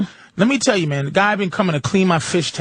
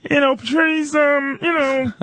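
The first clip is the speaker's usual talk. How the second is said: higher, hesitant tank-guy voice; lightly voiced hesitant fish guy; imitating the tank guy; 8 semitones higher than usual. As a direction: play him higher, stalling on the ums.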